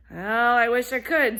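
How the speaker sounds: a hint of a sleepy dog begging off; lightly voiced lazy dog. Dog refuses to help the hen, lightly voiced and loud.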